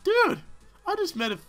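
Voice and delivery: high, loud shriek of discovery; excited realization. Real, not acted. Player shrieking as he cracks the story. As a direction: shriek it like a big reveal.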